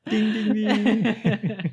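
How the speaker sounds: slow, high laughing ding ding. Acted. Cohost mocking the writer's idea, high laughing chime. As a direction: ring it out like a game-show bell, laughing. Mock light bulb moment.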